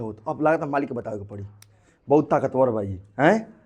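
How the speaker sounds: lightly acted defeat, low and soft; weakening antibody. Overpowered antibody to its master, admitting defeat. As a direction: give up quietly as the losing antibody. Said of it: "कमजोर पड़ने लगेगा तो कहेगा".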